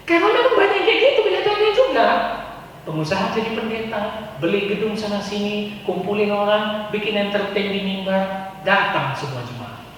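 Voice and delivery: ordinary talk, nothing marked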